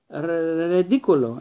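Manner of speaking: loud panicky shriek of the spell. A narrator's reading. Neville facing Snape boggart, shrieking the spell. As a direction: Neville shrieks the charm in fright. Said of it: "έσκουξε ο Νέβιλ".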